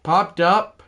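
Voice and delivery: high, loud announcer call of the out; play-by-play call. Pretend. A kid calling the baseball game to viewers, excited announcer voice. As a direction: call the game like a radio man.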